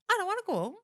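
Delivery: high-pitched mock reluctance; reluctant whine. Woman retelling her thought in the taxi, high reluctant whine. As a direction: act out not wanting to leave, pitched right up.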